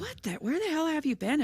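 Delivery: high, squeaky "what the" of disbelief; can't believe you missed it; shocked disbelief. Real, not acted. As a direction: friend stunned that the host missed the meme, high disbelief. Shocked friend doesn't know meme.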